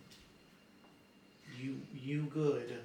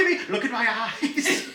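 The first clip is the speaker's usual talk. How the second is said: slow, loud, laughing; laughing creepy; 8 semitones higher, 8 dB louder, slower than usual. Acted. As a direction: drag it out with a laugh. Creepy NPC line, laughing.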